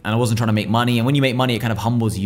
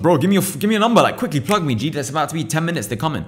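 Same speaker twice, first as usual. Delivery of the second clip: higher, hurried ask; urgent request; 6 semitones higher than usual. Retold or imitated. Begging the stranger for the barrister's number, urgent. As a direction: rush it, time is running out. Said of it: "I was like".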